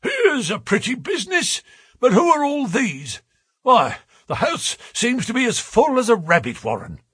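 high, husky blustering outburst; high-pitched muffled bluster; muffled, husky cry. A narrator's reading. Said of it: "he cried in a muffled, husky voice".

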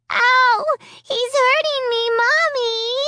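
put-on a small girl crying out for her mom; child in pain. A narrator's reading. Little Alicia calling to her mommy in pain. Child caught by puppet hand.

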